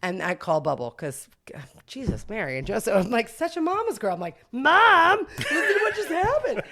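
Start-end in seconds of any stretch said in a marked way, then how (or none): 4.5-6.6 much higher, louder excited gush; bursting to tell mom the news; giddy high voice with a laugh. Retold or imitated.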